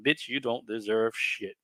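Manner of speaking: normal talking voice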